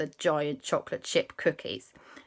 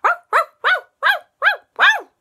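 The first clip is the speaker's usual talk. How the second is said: very high, loud yappy woofs; cartoon pup yapping at full pitch; ghost dog barking; 10 dB louder, faster than usual. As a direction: bark like an excited little dog.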